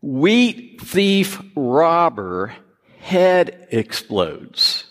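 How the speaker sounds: very slow, drawn-out headline read; slow deadpan headline voice; headline announcement. Acted. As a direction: announce it like a big newspaper headline. Reading out punchline headline.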